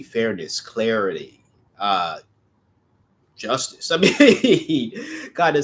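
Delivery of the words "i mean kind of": a bright laugh breaking into the words. Real, not acted. Reader laughing at how obvious the card is. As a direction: laugh it off, amused.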